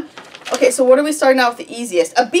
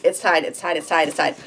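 normal talking voice

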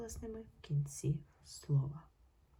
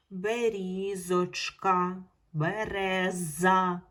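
"Берізочка, береза" very slow and louder, з ringing; teacher sounding the з slowly; drawn-out check words; 5 dB louder, much slower than usual. Shown as a demonstration. Teacher to pupils, slowly ringing the з. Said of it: "Чітко чути звук З".

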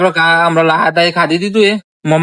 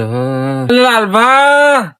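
higher, drawn-out call; 4 semitones higher, much slower than usual. Acted. Friend calls Lalbha for help, drawn-out call. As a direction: stretch the name as you call him.

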